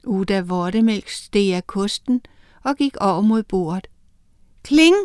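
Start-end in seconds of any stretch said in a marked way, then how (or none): 4.6-5.0 clink sound. A narrator's reading. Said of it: "sagde det".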